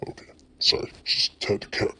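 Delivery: whispered quick apology; hushed aside. Real, not acted. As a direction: drop to a near-whisper for an aside. Aside after interrupting himself.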